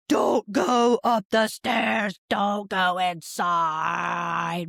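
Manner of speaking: very slow, drawn-out exaggerated yell; mock-monster mom yelling, ending in a blah; mock meltdown shout. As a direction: stretch each word into a hammed-up parent shout. Mother mocks her own overreaction.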